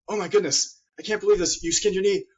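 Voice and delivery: a hammed-up alarmed parent; imitating a parent who panics over a scraped knee; panicky parent. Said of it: "they get really intense and kind of panicky".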